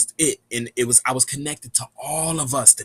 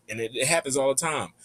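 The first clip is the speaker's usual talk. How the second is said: ordinary delivery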